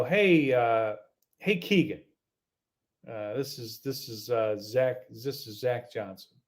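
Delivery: hesitant, low caller breaking bad news; reluctant phone call, voice dropping as he introduces himself. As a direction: start with a hey, then sink low and halting. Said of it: "tell by the tone of his voice".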